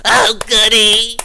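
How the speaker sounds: a demon thrilled by danger; gleeful demon. Acted. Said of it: "And Voskar goes".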